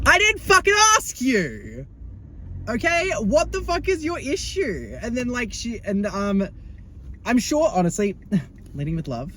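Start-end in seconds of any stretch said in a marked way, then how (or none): 0.1-5.0 much higher, slower, incredulous anger; outraged retort. Pretend. Snapping back at the classmate's sticky-face comment, high and outraged. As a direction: bite back, slowly.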